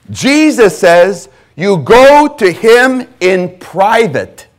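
loud, slow, high-pitched preaching, each never hammered home; preaching at full force. Acted. Pastor insists to his church they go privately, booming slow preaching. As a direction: thunder it at the congregation, word by word.

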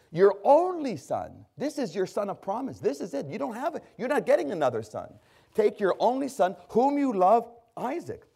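high, loud pulpit voice voicing God; preaching at full force. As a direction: thunder God's command from the pulpit. Preaches God's words with force.

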